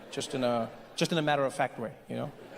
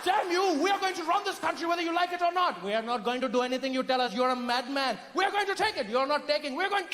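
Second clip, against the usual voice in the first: high, hammed-up imperial rage; pitched-up outraged British bluster; furious British colonizer; 9 semitones higher than usual. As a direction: rant as the colonizer losing his temper. Colonizer's outburst, taking over India.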